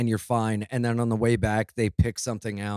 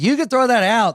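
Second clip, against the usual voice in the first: higher, louder, eager you can throw that out; mock eager offer; 10 semitones higher, 6 dB louder than usual. Telling police to toss his brass knuckles, mock eager. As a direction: blurt it out too eagerly.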